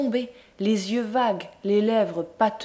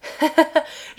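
high, loud tipsy laugh; drunken burst of laughter; merry laugh; 7 semitones higher, 3 dB louder than usual. A narrator's reading. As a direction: laugh out, amused by the wine. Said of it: "se mit à rire".

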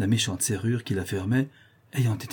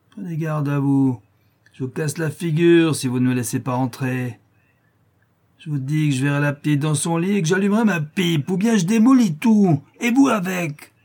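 put-on leering drunk, rising to a higher, louder threat; menacing drunk brute; 7 semitones higher, 6 dB louder than usual. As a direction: threaten her and build to a roar.

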